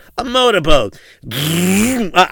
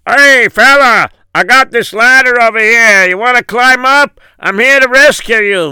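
much higher, louder call from above; helicopter rescuer; 7 semitones higher, 7 dB louder than usual. Acted. Pilot calls down to the man on the roof, high and loud. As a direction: shout down over the rotor noise. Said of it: "and he says".

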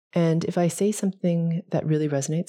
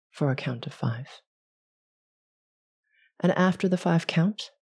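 guided meditation. Acted. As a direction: ease them through each breath. Leads a breathing meditation.

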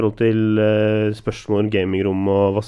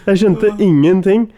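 laughing; 9 semitones higher, 5 dB louder, slower than usual. Real, not acted. Laughing at own confusion.

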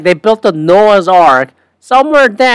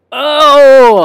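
sudden oh; 10 semitones higher, 7 dB louder than usual. Real, not acted. Back after turning off faucet.

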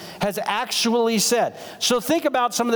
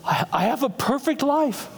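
higher, loopy awed voice; kooky bliss; 5 semitones higher than usual. Retold or imitated. Groggy son lying back in the chair, kooky bliss. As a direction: imitate a dazed patient's overjoyed wonder. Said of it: "obviously a little kooky".

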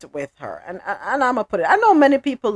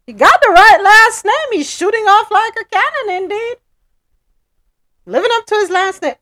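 excited; 12 semitones higher, 6 dB louder than usual. Real, not acted. Hyping the cannon name.

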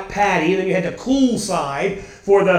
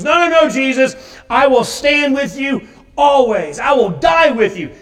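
fervent protest; 5 semitones higher, 5 dB louder than usual. Acted. Peter protesting to Jesus.

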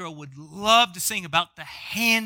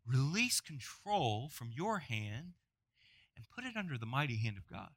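gentle plea; 5 semitones lower, 10 dB quieter than usual. Pretend. Preacher quoting Peter to worried believers, softer. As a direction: coax them to let go.

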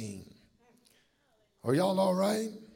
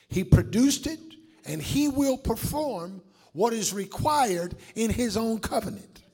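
usual speaking voice

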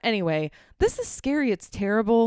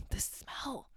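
disgusted whisper; 3 semitones higher, 14 dB quieter than usual. Real, not acted.

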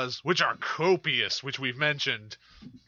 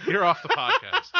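mock stern; 5 dB louder than usual. Mock firing co-host.

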